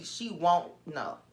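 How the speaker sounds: normal talking voice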